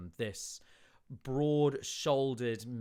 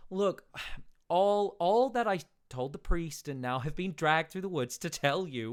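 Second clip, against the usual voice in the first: 1 exasperated; 7 semitones higher, 6 dB louder than usual. Acted. Sefik's exasperated outburst.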